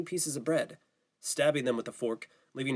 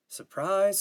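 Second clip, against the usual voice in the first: sarcastic; 7 semitones higher, 4 dB louder than usual. Acted. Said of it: "Steve responded".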